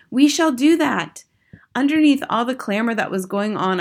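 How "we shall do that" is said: higher-pitched eager assent; raised, willing exclamation; crying out. Acted. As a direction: agree as a keen witch audience. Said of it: "cried the audience".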